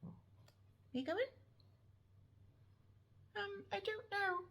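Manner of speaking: very high, slow pet voice speaking for the dog; squeaky high voice as if the dog answers; dog's voice. Pretend. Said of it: "That's my dog's voice".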